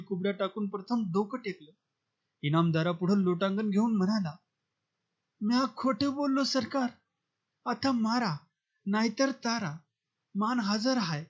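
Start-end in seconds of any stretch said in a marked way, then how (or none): 5.4-11.2 higher, humble servant voice begging mercy; a lightly voiced cringing beggar; groveling plea. A narrator's reading. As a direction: plead on your knees to the master. Beggar grovels before landlord.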